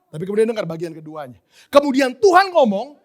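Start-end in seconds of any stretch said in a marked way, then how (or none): 1.7-3.0 loud, high, stretched proclamation; emphatic teaching. Retold or imitated. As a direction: proclaim it slowly for the class. Lecturer proclaims second part. (diserukan lantang, lambat, penuh penekanan)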